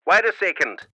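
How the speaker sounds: mum through a crackly walkie-talkie; easy denial over radio static; scratchy radio voice. A narrator's reading. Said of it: "the scratchy voice".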